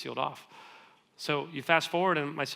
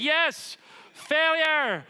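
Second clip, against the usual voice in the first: much higher and louder, a hammed-up cheer; mock American shout; 12 semitones higher, 5 dB louder, much slower than usual. Mocking Americans cheering the word failure, hammed-up shout. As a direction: belt it like a proud crowd. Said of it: "What Americans do loudly".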